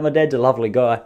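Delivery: usual speaking voice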